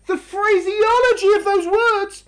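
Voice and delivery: normal talking voice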